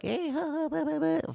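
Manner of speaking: put-on high, childlike babble; five-year-old showing off to adults; childish chatter. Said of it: "just talking shit like".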